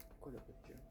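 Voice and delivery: whispered to himself. Real, not acted. Gamer whispering to himself while exploring the factory. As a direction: breathe the question under his breath. Puzzling over the game alone.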